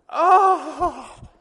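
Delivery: loud, high mock sob; mock wail. Woman at her door breaking down in tears, loud acted wail. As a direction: burst out in an exaggerated wail. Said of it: "Breaks down in this waking, quaking hovel of tears".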